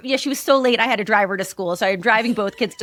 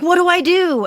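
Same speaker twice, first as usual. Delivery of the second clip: put-on flustered kid; 8 semitones higher, 5 dB louder than usual. Retells child's panicked question.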